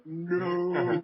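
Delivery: hammy drawn-out wail of denial; Darth Vader "No". Acted. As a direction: cry out a melodramatic no. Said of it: "cue the Darth Vader gif".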